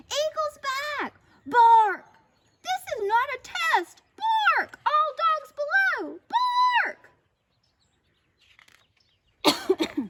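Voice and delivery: loud, high barking warning; barked alarm. A narrator's reading. Watchdog warns all dogs of eagle, barking. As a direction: bark it out like a siren. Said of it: "With a big bark".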